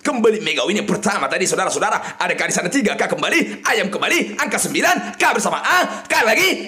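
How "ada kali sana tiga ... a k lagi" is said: ordinary delivery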